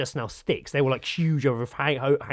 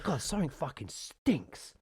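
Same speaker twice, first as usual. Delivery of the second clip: muttered disgust; 9 dB quieter than usual. Retold or imitated. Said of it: "I'm like".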